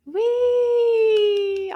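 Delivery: loud, high, long-held cheer; excited cheer. Real, not acted. Teacher cheering pupils' right answer, excited and loud. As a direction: celebrate with a big stretched shout.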